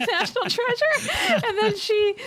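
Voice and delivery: high, giggling, stretched out; laughing. Real, not acted. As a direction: laugh at the silly idea. Laughing at imagined casting.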